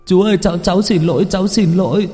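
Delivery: put-on a child wailing not to be beaten; terrified wail. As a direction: plead for your life, stammering. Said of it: "nó gào lên".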